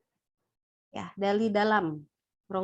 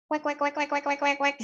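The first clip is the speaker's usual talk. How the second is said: shrill wek wek raised high to show a thin female voice; a teacher imitating a thin, squeaky voice for the class; 4 semitones higher than usual. Shown as a demonstration. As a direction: pitch up into a tinny squeak.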